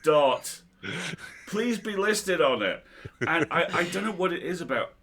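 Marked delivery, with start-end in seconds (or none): 0.0-2.8 mock salesman voice. Reading out a spam email.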